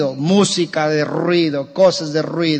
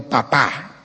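put-on child's word; 5 semitones lower, 5 dB quieter than usual.

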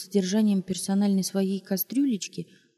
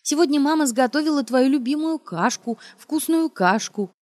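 put-on baby talk; 6 semitones higher, 4 dB louder than usual. Mother cooing to son about porridge, higher sing-song. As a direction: coo at him like a toddler.

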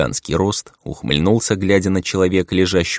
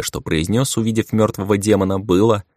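ordinary delivery, nothing marked

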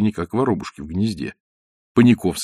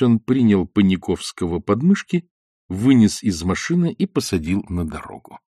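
ordinary delivery, nothing marked

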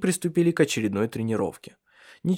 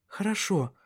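soft, higher, meek reply; subdued; 8 semitones higher, 5 dB quieter than usual. A narrator's reading. Woman yielding after his rebuke, soft and subdued. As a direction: give in quietly.

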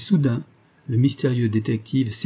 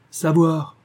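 sneering defiance; 5 semitones higher than usual. A narrator's reading. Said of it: "ricana Bobby Staff".

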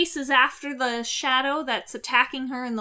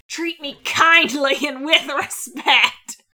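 mock outrage; 3 semitones higher, 7 dB louder than usual. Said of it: "Daphne is mad beyond belief".

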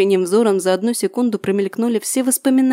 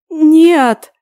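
high, loud heartbroken scream; anguished scream; 7 semitones higher, 10 dB louder than usual. Acted. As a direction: scream it with everything. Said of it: "надрывно закричала я".

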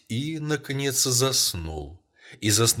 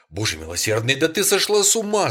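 a rising shout, much higher and louder; horrified shout; 6 semitones higher, 8 dB louder than usual. A narrator's reading. Husband shouts in horror at his wife's idea. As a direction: cry out in horror at her madness. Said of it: "закричал он".

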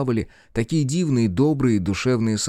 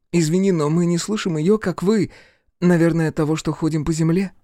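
put-on higher, eager young voice; curious boy; 10 semitones higher than usual. A narrator's reading. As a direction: ask like a wondering child. Boy speaking in story.

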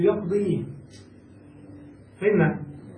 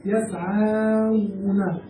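slow model word; much slower than usual. Shown as a demonstration. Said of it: "نقول هم".

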